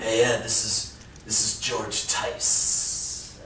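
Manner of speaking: put-on soft, slow, breathy older caller; a quiet airy voice on the phone; impression of George Tice. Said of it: "He talks like, kind of like that".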